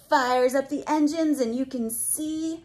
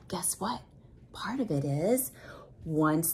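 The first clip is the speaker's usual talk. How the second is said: whisper; 5 semitones lower, 8 dB quieter than usual. Acted. Secret for the child.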